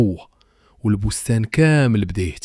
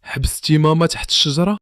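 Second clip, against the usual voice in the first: higher, shocked question; girl aghast at what her father did; gasping in shock; 6 semitones higher than usual. A narrator's reading. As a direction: disbelief rising in her voice. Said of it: "هينا شهقات".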